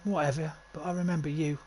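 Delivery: lightly put-on East London accent. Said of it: "I'm kinda giving him that accent".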